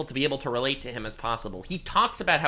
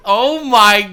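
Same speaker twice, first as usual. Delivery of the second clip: loud, slow laughing oh my God; laughing disbelief; 4 semitones higher, 14 dB louder, much slower than usual. Real, not acted. Streamer laughs in disbelief at the tweet. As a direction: laugh it off in shock.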